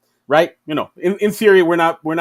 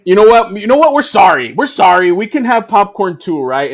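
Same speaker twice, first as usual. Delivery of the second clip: higher, louder hammed-up apology; mock apologetic bully; 3 semitones higher, 6 dB louder than usual. USA stand begs to share popcorn, hammed-up apology. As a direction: overdo the sorry bully. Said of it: "U S A popcorn's like".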